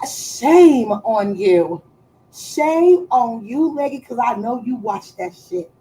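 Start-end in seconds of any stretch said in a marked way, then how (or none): 0.1-1.8 slow, louder, drawn-out scolding; mock shame. Mock scolding the viewer Leggy. 2.3-4.0 slow sing-song scolding; mock shame. Mock scolding the viewer Leggy.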